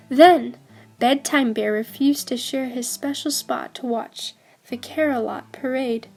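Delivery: ordinary delivery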